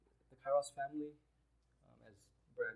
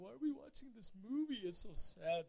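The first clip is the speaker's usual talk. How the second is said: mock tearful; 5 semitones higher than usual. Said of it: "We're like".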